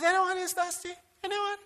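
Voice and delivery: high, soft, timid question; timid ask. Shown as a demonstration. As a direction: ask it meekly, almost to nobody. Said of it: "In a loud voice".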